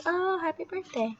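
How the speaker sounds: high voiced Oh, happy birthday; lightly high mock greeting; imitating greeters. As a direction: mimic a stranger's chirpy greeting. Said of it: "they're gonna be like".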